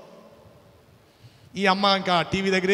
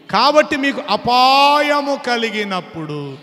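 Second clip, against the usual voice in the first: higher, louder preached scripture, slowing on key lines; loud pulpit proclamation of scripture; preaching at full force; 5 semitones higher, 4 dB louder than usual. Acted. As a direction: proclaim it like God rebuking the foolish. Reads God's words from Proverbs.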